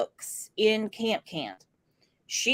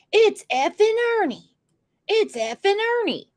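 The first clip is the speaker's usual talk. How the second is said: higher-pitched mimicked complaint; mock quarrel; 8 semitones higher than usual. Host re-voices the phone quarrel for viewers, mock-annoyed.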